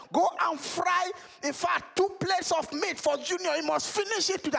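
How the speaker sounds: high, theatrical father scolding; stern father voice. Acted. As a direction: lay down the punishment like a pompous father. Father orders wife to fry meat.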